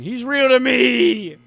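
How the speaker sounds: playfully indignant outburst, stretched out; mock outraged yell. Mock outrage defending the narrator.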